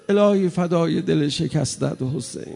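ordinary talk, nothing marked

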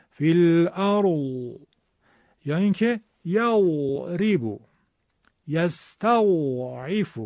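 الارض slowly with a long moaning zad at the end; slow Hejazi zad. Shown as a demonstration. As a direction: stretch the final zad like a dove's moan. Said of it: "زاد حجاز رو برای سکون".

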